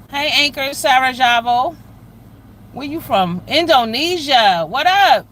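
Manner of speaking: loud, high, stretched-out greeting; excited shout-out. Real, not acted. Streamer hailing a viewer from Indonesia, excited and loud. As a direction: call it out big to a faraway viewer.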